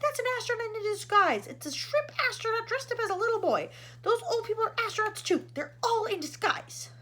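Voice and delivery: high, loud frantic shouting; loud agitated explanation. A narrator's reading. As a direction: rant it out urgently. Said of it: "shouted ground control".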